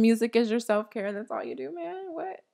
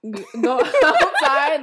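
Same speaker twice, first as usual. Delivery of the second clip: laughing; 4 semitones higher, 7 dB louder, slower than usual. Real, not acted. Laughs at the idea of exercise.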